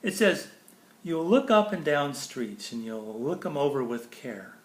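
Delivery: ordinary delivery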